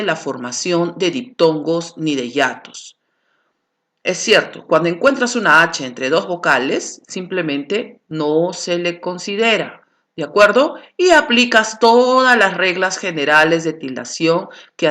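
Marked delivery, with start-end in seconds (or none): none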